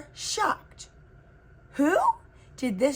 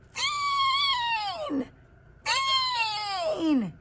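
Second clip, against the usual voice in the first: very high, yowling cry, falling off; monster yowl; much slower than usual. A narrator's reading. Creature yowls at the girl, very high then falling. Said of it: "Tunchia yowled".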